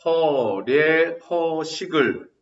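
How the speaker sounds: dragged out slowly for notes; slow dictation. Shown as a demonstration. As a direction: let them write it down. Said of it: "써놓으세요". (필기하게 천천히 불러주기)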